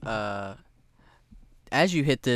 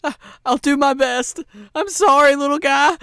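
high, loud, anguished character voice; Esmeralda, apologetic; 12 semitones higher, 8 dB louder than usual. Acted. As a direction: cry out a reluctant apology. Esmeralda apologises before attacking.

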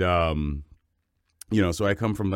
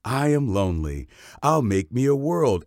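quoted line; 7 semitones higher, 4 dB louder than usual. Retold or imitated. Quoting a child's odd remark.

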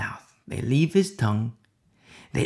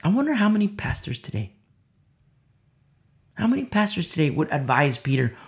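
ordinary talk

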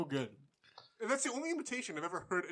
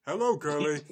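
Ed impression. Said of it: "[name] does Ed from, uh, Ed, Ed and Eddie".

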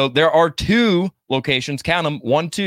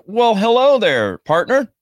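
cowboy voice; 7 semitones higher, 5 dB louder, slower than usual. Acted. Greeting co-host with country twang.

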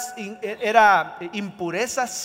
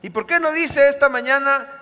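ordinary talk, nothing marked

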